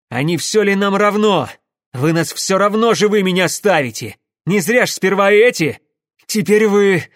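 hoarse outburst. A narrator's reading. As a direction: rasp it out loudly at your captors. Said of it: "прохрипел инженер". (хриплый отчаянный выкрик)

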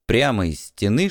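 ordinary delivery, nothing marked

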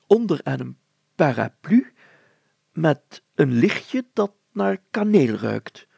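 mayor summing up in wonder; pondering mayor. Acted. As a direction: piece the story together. Mayor lists the clues.

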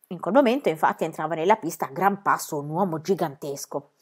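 plain reading voice

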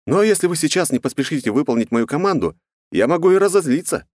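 high-pitched playful warning, rising at the end; smiling threat. Acted. Captain hurrying pirates to obey his order, playfully threatening. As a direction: threaten them lightly, lifting the pitch.

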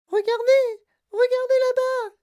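put-on very high, piercing girl's scream; shrieking like a madwoman. A narrator's reading. Veruca points at the other bank, crazed shriek. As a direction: shriek wildly for everyone to look. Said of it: "en hurlant comme une folle".

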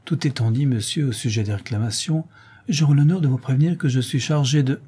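ordinary delivery, nothing marked

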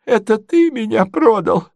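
very high, wounded questioning; doddering prince, betrayed. Acted. Prince accuses his nephew of betrayal, high and wounded. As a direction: ask plaintively in a high old-prince voice.

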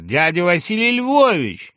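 high, loud happy recognition; delighted. A narrator's reading. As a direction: exclaim the name with joy. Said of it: "обрадовался гусар".

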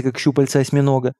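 ordinary delivery, nothing marked

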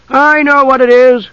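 put-on high, loud childlike outburst; excited cry. A narrator's reading. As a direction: blurt it out eagerly at top pitch. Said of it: "cried Tas".

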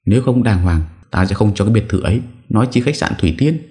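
high, brisk maternal warning; firm refusal. A narrator's reading. Mother withholding villa and hotel from her son, firm. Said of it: "nói giọng chắc nịch".

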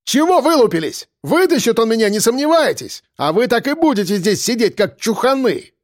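high-pitched spoilt brat outburst; indignant, bragging tirade; breaking into a shout. Acted. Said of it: "Вдруг сорвался он на крик".

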